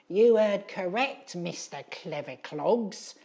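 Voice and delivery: put-on high, slow, gloating old woman voice; grinning old crone. Edith gloating at Gribley, a high slow grinning crone. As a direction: leer the words out like a smug old hag. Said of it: "grinned Edith".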